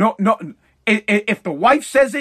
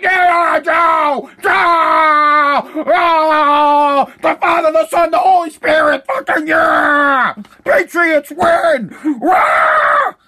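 high, drawn-out mock screams of worship; hammed-up fanatical cheering; 7 semitones higher, 6 dB louder, much slower than usual. Mocking Biden supporters going wild, fanatical cheering. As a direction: shout it like a delirious fan, louder and higher.

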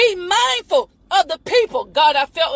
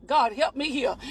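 softer, lower aside to God; muttered aside; 5 semitones lower, 7 dB quieter than usual. Real, not acted. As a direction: drop it under your breath.

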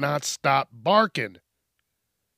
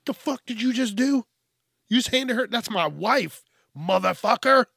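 high-pitched mock outrage, imitating an angry man; imitated indignant challenge, pitched up; outraged husband; 7 semitones higher than usual. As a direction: act out an affronted husband confronting a stranger. Acts out outraged husband's retort.

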